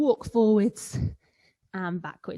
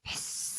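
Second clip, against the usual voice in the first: a tiny, much softer cat hiss; angry cat hiss; 11 dB quieter than usual. Pretend. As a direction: hiss softly like a cross cat. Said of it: "angry cat".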